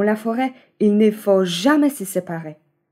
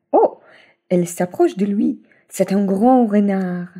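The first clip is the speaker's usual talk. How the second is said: plain reading voice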